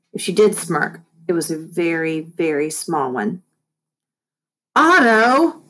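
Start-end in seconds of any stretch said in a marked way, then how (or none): 4.7-5.6 loud high call across the house; yelling. A narrator's reading. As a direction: yell the name. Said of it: "yelled Mom".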